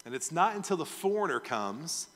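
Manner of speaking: ordinary delivery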